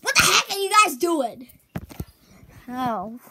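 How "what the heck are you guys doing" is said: high, loud disbelief; exasperated shout. Acted. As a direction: yell at them. Shouted scolding.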